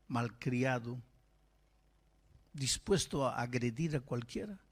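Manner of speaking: ordinary delivery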